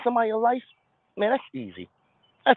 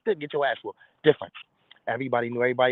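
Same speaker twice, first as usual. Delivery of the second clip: normal talking voice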